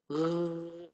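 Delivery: rolled throaty rrrr buzz; throat vibration. Shown as a demonstration. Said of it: "gegar situ". (getaran suara rrrr di kerongkong)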